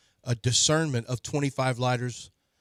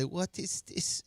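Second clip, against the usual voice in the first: put-on German accent; 4 semitones higher, 8 dB quieter, much slower than usual. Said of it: "Don't do your German accent anymore".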